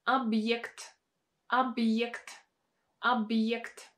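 объект slow, with y and e after the b. Shown as a demonstration. Teacher modelling объект, y after hard sign. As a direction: say объект as ab-yekt, y glide clear. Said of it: "pronounced as a combination of y and e".

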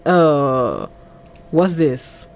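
low, hesitant question; retold puzzlement. Man reacting to what he is shown, mildly puzzled. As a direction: draw out a low 'uh' before asking.

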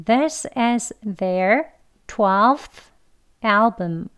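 This is their twelfth album broken into slow separate chunks. Shown as a demonstration. Teacher modelling the sentence slowly, word by word. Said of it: "문장 속에서 한번 연습해 볼게요".

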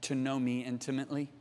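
low, soft, intimate reply; hushed and solemn; gentle divine answer. Retold or imitated. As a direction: answer tenderly and quietly, in a retold voice of God. Voices the Holy Spirit's answer.

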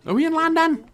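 high, hammed-up British "Landan?"; mock British accent. Teasing the game's London, high mock British accent. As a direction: squeak it like a quaint Englishman. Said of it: "Sherlock's accent".

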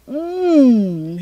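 loud, high mmm of delight; hammy yummy hum; delighted exclaim. Acted. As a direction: savour it loudly. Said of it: "he exclaimed".

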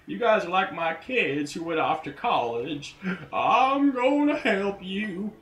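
high, put-on gentle giant voice, slowing at the end; voicing the troll. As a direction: play the kindly troll talking to his kids. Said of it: "and he's like".

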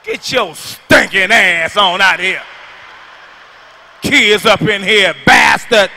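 loud, slow angry yell; hammed-up furious wife; mock wife yelling. Wife yells at farting husband, slow and loud.

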